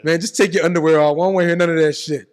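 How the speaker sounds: loud bossy cop; commanding cop. Retold or imitated. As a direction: order him around. Cop ordering him.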